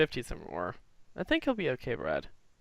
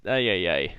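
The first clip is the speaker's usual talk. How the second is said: Jersey Mother voice; 8 semitones lower, 5 dB louder than usual. Acted.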